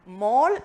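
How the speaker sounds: മോൾ said with a long rounded o, like mole; correct pronunciation. Shown as a demonstration. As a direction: say മോൾ as mole, not മാൾ. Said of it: "കറക്റ്റായിട്ട് pronounce ചെയ്യുന്നത്". (മോൾ എന്ന് ശരിയായ ഉച്ചാരണം)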